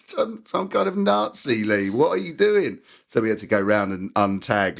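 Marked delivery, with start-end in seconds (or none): 0.1-2.8 high, slow, aghast at a friend; scolding Lee in disbelief; exasperated disbelief. Retold or imitated. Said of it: "He said".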